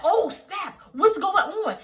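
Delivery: high, loud, half-laughing shock; mock alarm. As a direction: blurt it out in hammed-up alarm. Retold alarmed reaction, mock panic.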